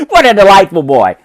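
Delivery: plain reading voice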